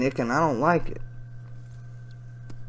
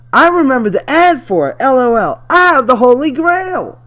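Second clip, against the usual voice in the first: higher, louder put-on voice; excited chat voice; 12 semitones higher, 11 dB louder than usual. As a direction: read the messages high and excited. Reads chat in high voice.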